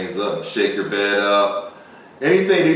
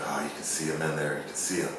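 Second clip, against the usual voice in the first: softer hushed aside; 6 semitones lower, 12 dB quieter, faster than usual. Retold or imitated. Hunter hushing while spotting the ghost, softer and hushed. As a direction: point it out under your breath.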